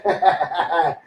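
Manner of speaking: low short mocking chuckle; scornful laugh. Real, not acted. Mocking Corbyn on his Facebook live, a low scornful laugh. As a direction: laugh it off with contempt.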